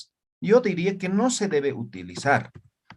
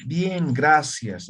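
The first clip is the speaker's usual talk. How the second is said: slow gloss; 2 semitones lower, much slower than usual. Shown as a demonstration.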